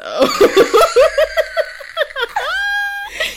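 high, loud cackling laughter; loud laughing fit. Real, not acted. Host reveals who the groom is, loud high laughter. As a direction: burst out laughing, long and high.

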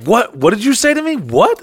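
high-pitched, incredulous challenge; rising, mock-affronted questions; imitated indignant disbelief. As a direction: act out a friend taking offense. Acts out friend's offended reaction.

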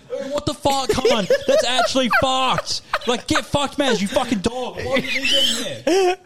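high-pitched laughing mock outrage; retold disgusted outburst. Housemate retells finding it in shower, mock-disgusted protest.

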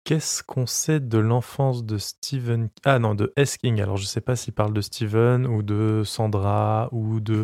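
very slow, deliberate reading; over enunciating. Acted. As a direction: spell out each word slowly. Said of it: "je vais parler très lentement".

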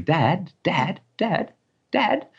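put-on high calling child's voice, rising; screaming child. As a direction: call out like a toddler wanting dad. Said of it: "starts screaming".

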